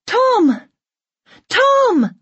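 very high, urgent name call; shouting. A narrator's reading. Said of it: "Lisa shouts".

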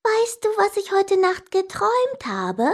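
high-pitched pestering sister; insistent repeated question. A narrator's reading. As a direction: pester him in a high voice, rising at the end. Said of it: "aber sie fragte schon wieder".

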